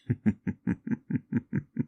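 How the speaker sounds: airy, breathy mocking chuckle; a cruel little laugh at the mortal's expense. Demon mocks the mortal.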